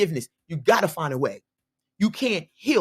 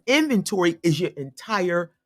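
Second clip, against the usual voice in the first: ordinary talk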